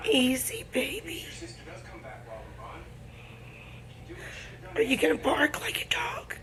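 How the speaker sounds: soft hushed whisper. Real, not acted. Hushing a baby.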